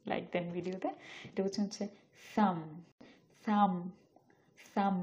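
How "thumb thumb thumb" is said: thumb ending on m, no b sound; silent b. Shown as a demonstration. Teacher showing thumb has a silent b. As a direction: stop thumb on the m. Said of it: "ম পর্যন্ত এসে থেমে যাবে".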